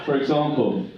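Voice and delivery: ordinary talk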